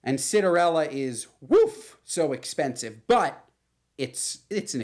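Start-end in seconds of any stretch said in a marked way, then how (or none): 1.4-2.0 exaggerated woof. Real, not acted. Reacting to prices.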